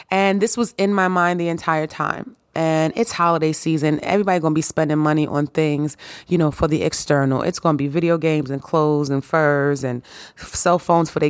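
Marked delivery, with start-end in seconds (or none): none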